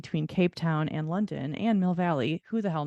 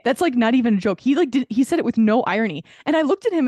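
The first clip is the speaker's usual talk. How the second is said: animated insistence; 4 semitones higher, 8 dB louder than usual. Real, not acted. Insisting it really happened.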